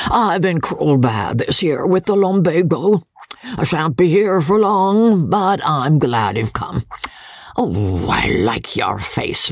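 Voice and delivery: put-on old country lord greeting guests with a cackle; coarse old baronet. Old baronet teasing his son's wife, leering. Voices old Sir Pitt.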